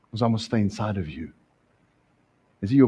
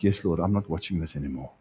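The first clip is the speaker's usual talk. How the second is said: soft surrendered reply; 9 semitones lower, 6 dB quieter, faster than usual. Retold or imitated. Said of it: "And you're like".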